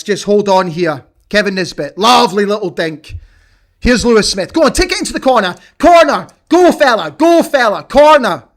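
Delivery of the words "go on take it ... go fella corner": high, loud urging, rushed at the start; excited urging. Real, not acted. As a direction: drive the winger on toward the corner. Urging winger into corner late on.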